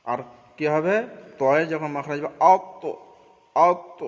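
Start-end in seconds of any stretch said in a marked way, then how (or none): 3.5-4.0 আত with the final ত bounced, loud and higher; qalqala bounce. Shown as a demonstration. Teacher shows the bouncing qalqala on আত. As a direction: snap the ত in আত out with an echoing release. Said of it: "কলকলা হবে".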